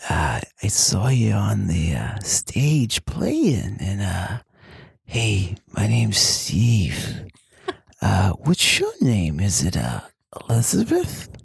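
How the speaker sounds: a third grader trying to come on to her, breathy and slow; seductive third grader. Acted. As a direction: Steve hitting on a singer at the bar, hushed and slow. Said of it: "You sound like a seductive third grader".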